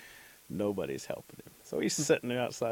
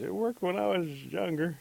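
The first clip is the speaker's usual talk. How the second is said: put-on old medicine man; 9 semitones higher, 5 dB louder than usual.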